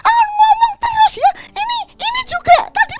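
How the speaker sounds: shrill high shouting nag; nagging wife. Retold or imitated. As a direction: scold your husband loudly about the trash. Istri mengomel pada suami. (istri mengomeli suami soal sampah, melengking keras)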